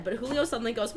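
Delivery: ordinary delivery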